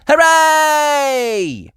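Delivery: high, loud hooray; cheer. A narrator's reading. Everyone cheering the victory, high and loud. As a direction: cheer all together. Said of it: "Everyone cheered".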